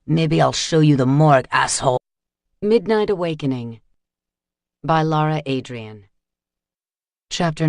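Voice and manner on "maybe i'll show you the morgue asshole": louder, menacing retort; seething threat. A narrator's reading. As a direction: threaten him through clenched rage. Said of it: "the thug seethed back".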